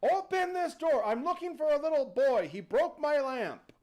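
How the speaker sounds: high, loud demand through a door; demanding genie. Acted. Genie knocks on the door seeking the boy, loud demand. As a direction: holler to be let in. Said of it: "he's knocking on the door".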